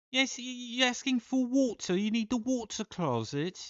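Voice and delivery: very high, put-on English voice explaining; mock English accent. English local steering the American to the water closet, in a mock English accent. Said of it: "they were like".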